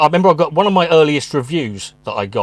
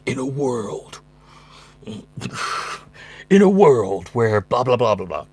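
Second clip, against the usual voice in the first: movie trailer voiceover; slower than usual. Retold or imitated. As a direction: intone it like an epic eighties trailer. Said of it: "every trailer started the same".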